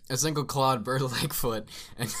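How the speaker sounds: real laughter creeping into the reading; laughing while reading. Real, not acted. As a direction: try to read on but crack up.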